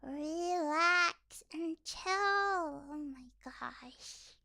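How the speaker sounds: very drawn-out words; slow soothing exasperation. Real, not acted. Streamer telling sensitive viewers to relax, slowly drawled. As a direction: stretch it right out, easing off in volume.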